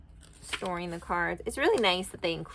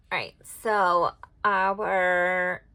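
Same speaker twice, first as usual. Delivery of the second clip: usual speaking voice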